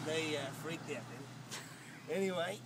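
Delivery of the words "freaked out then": usual speaking voice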